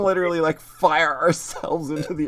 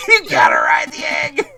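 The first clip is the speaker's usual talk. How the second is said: loud high yell; shouted meme; 12 semitones higher, 8 dB louder than usual. Retold or imitated. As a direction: belt out the meme line.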